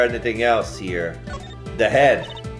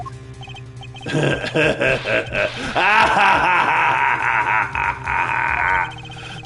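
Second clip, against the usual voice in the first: evil laugh. Acted. Villain laughing.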